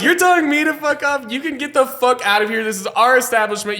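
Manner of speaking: how dare you, then kick her out; indignant retort. Retold or imitated. Bartender answering the woman who said fuck off, indignant outrage.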